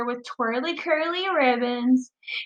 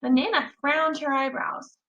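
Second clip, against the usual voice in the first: ordinary delivery, nothing marked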